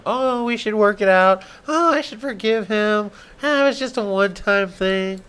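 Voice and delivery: high, whiny mock girlfriend; high voice mocking her excuses.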